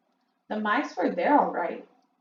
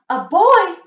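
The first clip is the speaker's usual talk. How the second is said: high, loud shocked cry; witches crying out; 11 semitones higher, 11 dB louder than usual. A narrator's reading. Witches react to news of a boy, high and loud. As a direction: gasp it out in alarm, loud. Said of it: "cried the witches".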